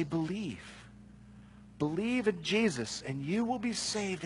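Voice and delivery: soft, hushed and unhurried; gentle invitation. Pretend. Apostles telling the jailer how to be saved, soft. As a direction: offer it gently, like an open hand. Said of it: "They just say".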